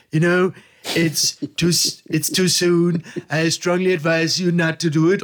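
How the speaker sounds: halting, slurred-sounding Trump parody; Trump impression. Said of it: "Trump-Impression". (Trump-Parodie, stockend auf Englisch)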